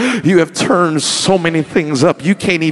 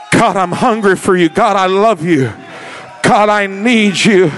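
spoken prayer; 5 semitones higher, 4 dB louder than usual. Acted. Pastor leading the church in crying out to God, fervent. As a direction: lead the congregation in a fervent, raised prayer. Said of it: "cry out to God".

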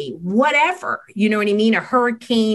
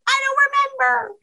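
put-on high, whiny kid voice, much higher; whiny child; much faster than usual. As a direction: play the upset kid who can't remember. Upset child voiced by expert.

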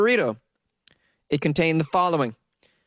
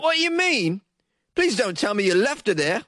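higher-pitched put-on British accent, quoting the son; 3 semitones higher than usual. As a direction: read the son's quote in a mock British accent. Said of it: "I can't do this accent anymore".

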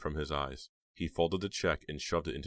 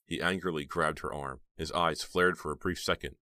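ordinary delivery, nothing marked

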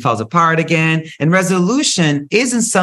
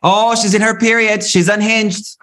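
1 mocking dismissal; 7 semitones higher than usual. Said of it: "that language".

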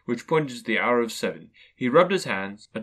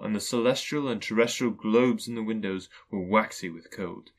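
usual speaking voice

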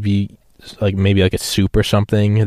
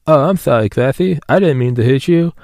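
South Park voice; 4 semitones higher than usual. Retold or imitated. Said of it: "God, I love South Park".

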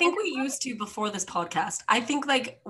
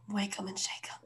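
whispered "Wake 'em and shake 'em" with no voice; hushed whisper of the catchphrase; 10 dB quieter than usual. Shown as a demonstration. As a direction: breathe it out as a secret. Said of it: "Wake 'em and shake 'em".

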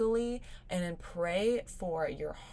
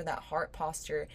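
normal talking voice

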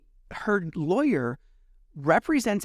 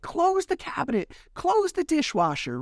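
high-pitched mock yelling; retold quarrel yell; 12 semitones higher than usual. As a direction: re-enact calling out in exasperation at much higher pitch. Replaying own yelling in recorded quarrel.

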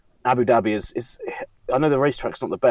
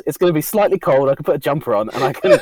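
imitating Chris's thinking; 8 semitones higher, 6 dB louder than usual. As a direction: play out his dithering thoughts, raised pitch. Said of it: "One of them was".

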